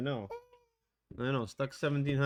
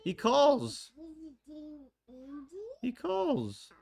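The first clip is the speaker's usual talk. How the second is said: high whiny voice; much slower than usual. Pretend. High disbelief.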